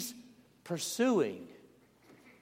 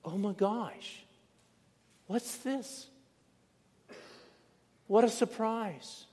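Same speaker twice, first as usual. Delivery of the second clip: higher, slow gasp of wonder at a find; acted amazed surprise; 7 semitones higher than usual. Workers discovering hidden treasure in field, amazed surprise. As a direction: stretch out the oh my gosh in awe, pitch up. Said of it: "all of a sudden it's".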